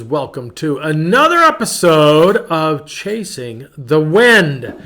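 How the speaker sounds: loud, high, drawn-out hello; big greeting. Real, not acted. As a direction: greet the listeners with gusto. Podcast host greeting listeners.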